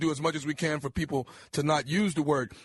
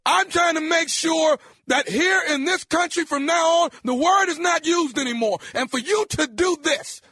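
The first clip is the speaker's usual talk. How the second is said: yelling, pitched way up; angry shout; 10 semitones higher, 4 dB louder than usual. Acted. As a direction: bark it at him, furious. Prank caller yelling at target.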